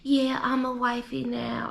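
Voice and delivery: slow, dopey mock of her cadence; mocking slow talk. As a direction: drag the words as if half asleep. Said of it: "she's a little slow".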